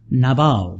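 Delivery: "Nabal" ending in a stressed, open "bahl"; na-BAHL pronunciation. Shown as a demonstration. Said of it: "it probably is pronounced".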